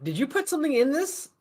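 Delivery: confused and suspicious, pitch jumping up. Acted. Player voices a bewildered man questioning his drink, confused.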